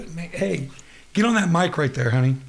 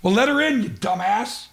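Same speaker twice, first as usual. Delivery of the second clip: high, raised-voice exasperation; exasperated scolding; 8 semitones higher, 6 dB louder than usual. Acted. Host to producer about the waiting guest, exasperated.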